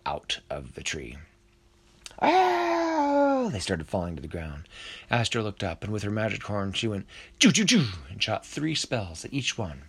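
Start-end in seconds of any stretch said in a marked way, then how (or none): 2.2-3.5 long, high, loud scream; drawn-out high-pitched "ah"; falling scream. A narrator's reading. Lizards fall from the tree. 7.4-7.9 three high, loud zaps; punchy high "choo" bursts; magic zap noises. A narrator's reading. Imitates magic horn firing spells.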